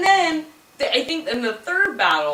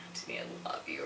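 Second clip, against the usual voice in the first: whispered mock last words; hushed, play-acted deathbed murmur; dying whisper; 20 dB quieter than usual. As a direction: whisper it like a final breath.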